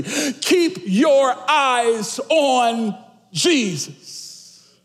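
high, very slow, drawn-out proclamation; prophetic declaration. Real, not acted. Preacher tells the church to hear this prophetically, slow proclamation. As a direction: declare it word by word, stretching each one. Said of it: "Hear this prophetically".

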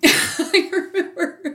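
high, slow, breaking into laughter; laughing. Real, not acted. Amused mom starting a funny family story, laughing. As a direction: laugh through the words. Amused by her own memory.

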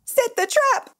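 extremely high cry, rising at the end. A narrator's reading. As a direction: repeat the order as a high-pitched cry. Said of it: "cried Maman".

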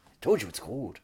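normal talking voice

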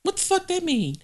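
high, indignant imitation of her; mocking high-pitched outrage; mock outraged cast member. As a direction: squeal it like the offended reality star.

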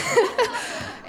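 bright burst of laughter; high laugh. Real, not acted. Laugh after frogs.

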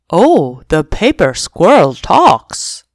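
a lightly voiced surprised dog; dog crying out. Dog finds the kitten talks, surprised dog voice. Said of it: "the dog cried".

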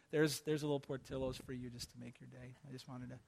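a soft, giving murmur; kind gift voice. Pretend. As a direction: hand over a gift to an enemy, tenderly. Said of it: "your God impersonation".

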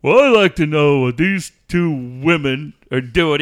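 loud, blustering caller voice; cranky caller. Retold or imitated. Prank caller scolding two women hosts, loud and blustery. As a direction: boom it out like an indignant old caller. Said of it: "Joe in San Diego".

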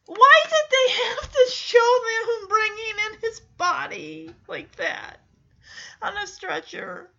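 real tearful protest at the scene; upset crying outburst. Real, not acted. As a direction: blurt it out, half sobbing. Viewer upset at sad scene.

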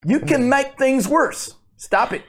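loud, high-pitched mock rant. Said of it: "does he do this voice?".

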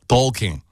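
Talking said as "tolkin", no final G sound; no G at end. Shown as a demonstration. Host shows cohost the English ending, no final G. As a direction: drop the G: "Talkin". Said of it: "Bak, G yok sonunda".

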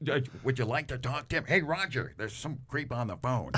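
put-on character fobbing off a caller; radio guy voice. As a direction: pretend to call Roger over the phone, deadpan. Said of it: "it's like".

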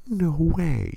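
high, incredulous wife; imitating the wife's disbelief; mock disbelief. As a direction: gasp out the denial. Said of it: "She's like".

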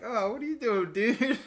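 high, loud, laughing mock surprise; startled and tickled, calling out to the critter; amused surprise. Surprised at chipmunk by feet.

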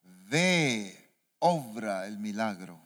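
lightly voiced angel, low and slow; solemn, drawn-out order from an angel; angel's voice. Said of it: "la voz del ángel del Señor".

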